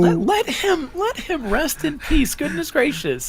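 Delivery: high-pitched exasperated protest; exasperated plea. Real, not acted. Co-host protests a character's return, exasperated plea. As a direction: plead in comic exasperation, voice shooting way up.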